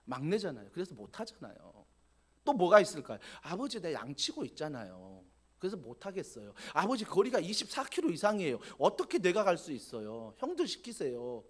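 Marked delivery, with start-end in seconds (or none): 0.0-1.9 soft, low sulky excuse to father; a youngest son sulking to his father, softly and low; whiny excuse. Retold or imitated. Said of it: "어떻게 말할 수 있을까요". 3.4-11.4 soft, low grumbling backtalk; a youngest son talking back to his father, grumbling softly. Retold or imitated. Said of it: "이렇게 대꾸할 수 있거든요".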